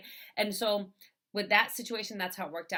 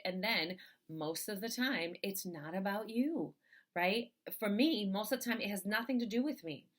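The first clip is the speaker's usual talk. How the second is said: ordinary delivery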